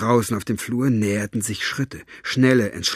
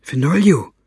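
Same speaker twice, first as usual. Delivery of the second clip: louder, higher hushed-urgent call; 5 semitones higher, 8 dB louder than usual. Acted. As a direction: call out to wake a sleeper. Girl urgently waking a sleeping man.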